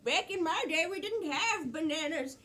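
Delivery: slow, lightly voiced old person; slow old-timer delivery. Shown as a demonstration. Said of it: "they might say something like".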